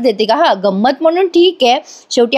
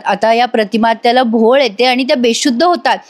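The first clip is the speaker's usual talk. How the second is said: usual speaking voice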